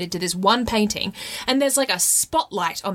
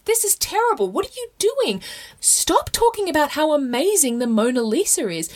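high-pitched, scandalised scolding; hammed-up dismay; mock horror; 6 semitones higher than usual. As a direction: act appalled at the crowd, pitched up. Acts out scandalised reaction to crowd.

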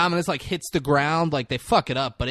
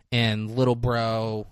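ordinary talk

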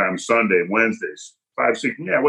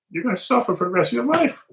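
tearful officer; 14 semitones higher than usual. Retold or imitated. Officer in tears, quoted.

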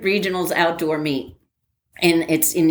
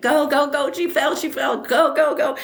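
higher, mock cheering yell; 10 semitones higher than usual. Teammates screaming for Stephanie at the finish, mock cheering. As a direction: urge her on from the stands. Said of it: "we're screaming and screaming".

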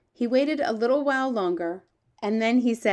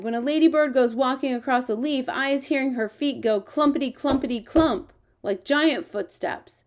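higher-pitched storybook giant with quaint grammar; lively, raised giant character voice; eager, boastful giant; 5 semitones higher, 5 dB louder than usual. Acted. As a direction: play the friendly giant, proud of his hearing. The giant's lines in a read story.

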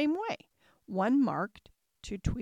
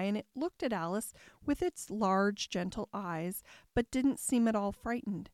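ordinary delivery, nothing marked

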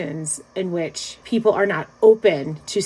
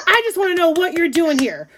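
high-pitched indignant demand; mock outrage, pitched well up; retold rage; 8 semitones higher than usual. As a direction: imitate an exasperated man insisting. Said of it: "like, in a rage".

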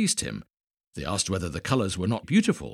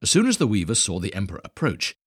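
plain reading voice

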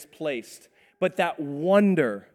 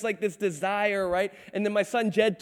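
usual speaking voice

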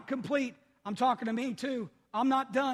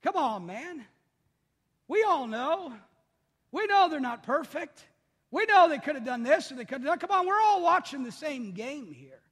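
exasperated fan; 6 semitones higher, 7 dB louder than usual. Retold or imitated. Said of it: "people turn around like".

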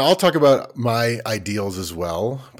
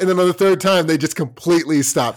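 laughing; 8 semitones higher, 5 dB louder than usual. Real, not acted.

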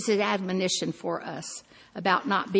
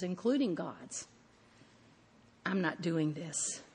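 usual speaking voice

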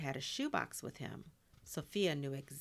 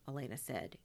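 ordinary delivery, nothing marked

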